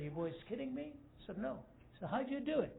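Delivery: high, incredulous questions; can't believe his men did it; astonished David. Acted.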